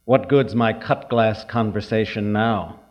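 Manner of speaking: usual speaking voice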